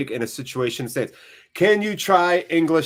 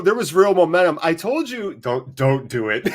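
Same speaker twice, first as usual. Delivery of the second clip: ordinary delivery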